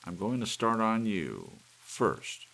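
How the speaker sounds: loud, high menacing taunt; man with hammer taunting the cuckoo; gloating threat. A narrator's reading. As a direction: savour the threat. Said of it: "he smiled".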